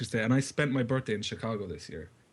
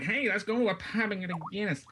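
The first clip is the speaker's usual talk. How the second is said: mock American tourist; 7 semitones higher than usual. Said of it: "And like".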